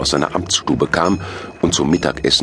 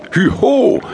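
high, loud giddy-up cry; calling out; 5 dB louder than usual. A narrator's reading. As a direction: urge the reindeer on at high pitch. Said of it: "rief Nickel".

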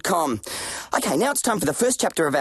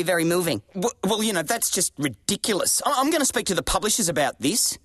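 normal talking voice

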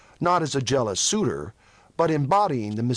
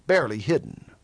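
plain reading voice

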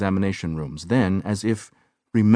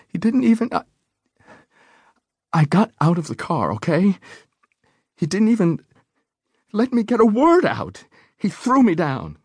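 much higher, urgent, shaken retelling; distraught; 13 semitones higher, 3 dB louder than usual. A narrator's reading. Woman telling the doctor how she was thrown down, distraught. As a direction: push through the words in distress.

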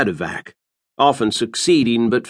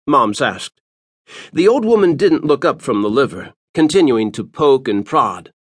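plain reading voice

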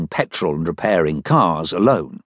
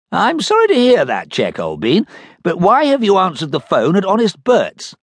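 captain's voice; 10 semitones higher, 3 dB louder than usual. Acted. Captain talks to Dud.

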